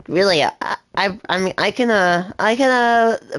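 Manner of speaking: put-on climbing into a young voice. Shown as a demonstration. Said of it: "I can get my voice like this".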